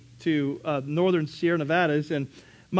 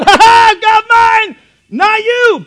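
much higher, louder mocking laugh-shout; gloating shout; 18 dB louder than usual. Woman gloating at her neighbours, loud taunting.

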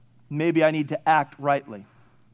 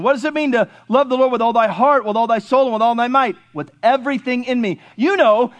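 normal talking voice

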